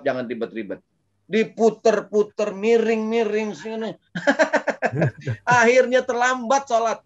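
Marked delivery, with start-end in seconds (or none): 1.3-3.9 high, slow mock fussing over a headscarf; fussy hijab winding. Preacher mocking women who fuss over their hijab, high and slow. 4.7-7.0 loud laugh breaking into the line. Real, not acted. Preacher laughing at his own hijab joke, loud.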